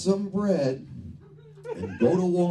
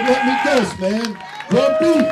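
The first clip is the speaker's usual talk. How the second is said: much louder, higher mocking jab; sarcastic guess; 9 semitones higher, 8 dB louder than usual. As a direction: hit the punchline hard and high. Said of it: "I said".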